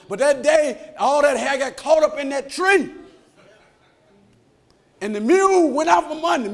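high, driving pulpit cadence; preaching at full force. Real, not acted. Preacher telling Absalom's story to the church, preaching forcefully.

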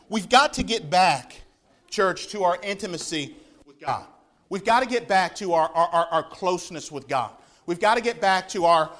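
rally them back to God; urgent preaching. Acted. Preacher calling his church back to intimacy, urgent.

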